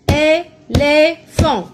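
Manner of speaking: éléphant loud and slow, é - lé - phant; syllable by syllable. Shown as a demonstration. Teacher claps the word for pupils, loud separate syllables in éléphant. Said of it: "Combien de syllabes?".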